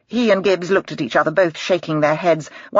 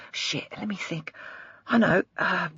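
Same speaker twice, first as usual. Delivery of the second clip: muttering to himself; 3 semitones higher, 8 dB quieter than usual. Acted. Trying to recall a name.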